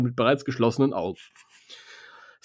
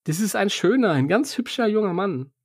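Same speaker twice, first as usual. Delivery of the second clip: playful gushing; 7 semitones higher than usual. Pretend. Playfully insists on the pretty boy.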